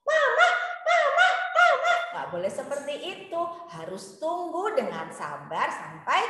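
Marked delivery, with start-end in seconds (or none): 0.0-1.9 put-on high child calling Mama; whiny child calling. Shown as a demonstration. Teacher demonstrating rude child, high whiny calling. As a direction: show the rude interrupting call. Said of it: "tidak boleh bilang".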